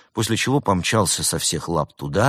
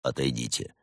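soft, low, curt order; quiet authority, pitched well down; low command; 6 semitones lower, 7 dB quieter than usual. A narrator's reading. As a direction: order someone off in a controlled low voice. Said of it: "велел брат Немрод".

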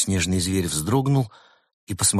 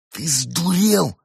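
furious hiss; 9 semitones higher, much slower than usual. A narrator's reading. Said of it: "яростно прошипел Кот".

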